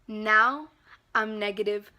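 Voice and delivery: ordinary talk, nothing marked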